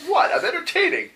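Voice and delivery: high-pitched mock affront; indignant protest. [name] objects to the server, high indignant protest. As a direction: squeak it out, put out.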